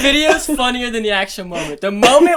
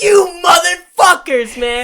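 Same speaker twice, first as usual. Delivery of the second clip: mock angry; 8 semitones higher, 5 dB louder, slower than usual. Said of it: "We're like".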